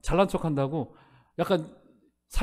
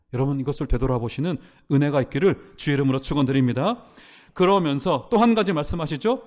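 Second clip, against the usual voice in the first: usual speaking voice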